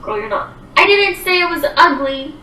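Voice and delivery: ordinary talk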